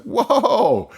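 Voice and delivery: high, laughing mock surprise; startled whoa. Teen reacting to dad's harsh warning, high laughing surprise. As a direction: yelp it up high, half laughing. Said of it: "And I was like".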